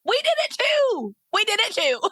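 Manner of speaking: very high, delighted squeal; real excitement. Real, not acted. Host learning guest used the same method, real high-pitched excitement. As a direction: squeal with happy recognition.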